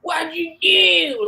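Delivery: high, drawn-out Baltimore accent, mock yell. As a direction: stretch out an imitation Baltimore scolding. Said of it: "somebody yelling at somebody like".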